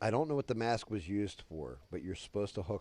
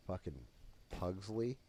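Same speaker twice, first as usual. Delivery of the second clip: ordinary talk, nothing marked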